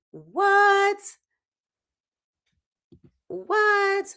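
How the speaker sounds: high squeaky "What?"; mock disbelief. Shocked viewer hearing of grenades, high squeak.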